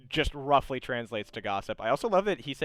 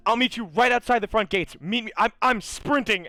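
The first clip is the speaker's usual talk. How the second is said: high, loud urgent character voice; raised, hurried-sounding phone exclamation; acted frantic excitement; 9 semitones higher, 6 dB louder than usual. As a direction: blurt it out, bursting with eagerness. Foji excitedly rushing to meet them.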